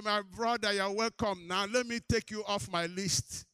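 loud, high re-enacted welcome; joyful welcome. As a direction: greet a new brother at the top of your voice. Cousin's welcome to new convert, retold.

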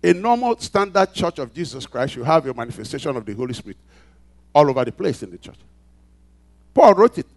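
loud, high, driving pulpit voice; preaching at full force. Acted. Pastor rousing the congregation, preaching at full force. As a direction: build it up and hammer each phrase. Preaching peak about church gifts.

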